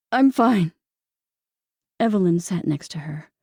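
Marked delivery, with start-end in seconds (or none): 0.1-0.7 strained, louder I'm fine; barely holding back tears. A narrator's reading. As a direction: force the words out. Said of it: "She could barely get the words out".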